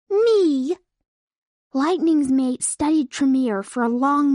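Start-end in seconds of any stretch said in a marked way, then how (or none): 0.1-0.8 put-on proud young unicorn, high and bold; heroic boast. A narrator's reading. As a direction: puff up and claim it proudly. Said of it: "struck a heroic pose".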